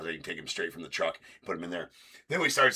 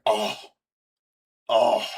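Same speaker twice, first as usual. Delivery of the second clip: mock scoffing clerk, louder; imitating a scoff; 2 semitones higher, 7 dB louder than usual. Counter ladies scoffing at his packages, mock scoff. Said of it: "you'd hear 'em scoff".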